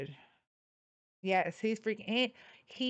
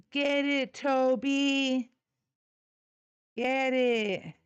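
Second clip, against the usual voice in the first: egging on dog; 4 semitones higher, 4 dB louder, much slower than usual. Real, not acted.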